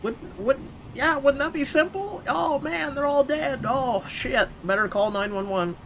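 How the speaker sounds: high, silly voice playing a clueless witness; mock naive victim. Mocking a naive horror victim.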